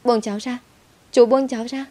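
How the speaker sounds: high, rising panicked cry; terrified scream. A narrator's reading. Little girl screaming at the man grabbing her. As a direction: scream to be let go. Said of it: "khiến nó khóc thét lên".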